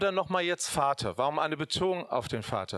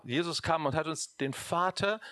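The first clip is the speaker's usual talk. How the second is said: ordinary talk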